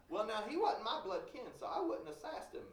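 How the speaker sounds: ordinary talk, nothing marked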